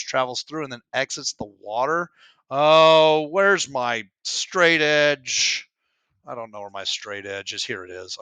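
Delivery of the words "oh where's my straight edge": exasperated search. Real, not acted. Searching for a lost tool.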